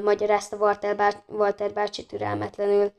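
ordinary delivery

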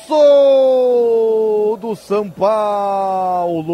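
long drawn-out goal shout; explosive goal commentary. Real, not acted. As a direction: stretch the golaço, full roar. Golaço, long held shout.

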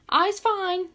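high, loud little squeak; squeaky little creature. A narrator's reading. Freed follower reassuring his leader, high and squeaky. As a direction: squeak it out bravely. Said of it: "squeaked Squeeze Juice".

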